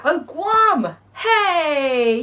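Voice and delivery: high, delighted cry of recognition; excited discovery. Real, not acted. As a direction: exclaim it high as you suddenly spot it. Spots Guam on the map.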